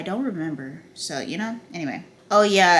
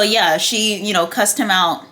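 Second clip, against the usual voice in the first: normal talking voice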